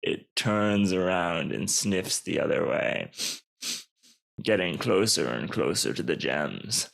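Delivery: low, slow narrator; deliberate, low storytelling voice; suspense narration. A narrator's reading. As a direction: draw out the creeping threat.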